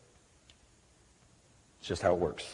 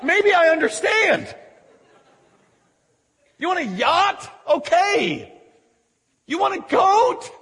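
high-pitched, exasperated disbelief; incredulous mockery; 9 semitones higher than usual. Father answering son who asked for a goat, incredulous. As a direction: challenge him as if the request is absurd.

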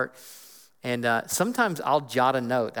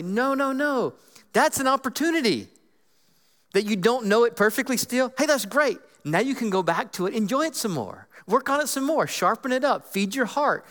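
excited encouragement; 9 semitones higher than usual. Retold or imitated. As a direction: cheer them on as if it's great news. Retold pep talk to discouraged memorizer.

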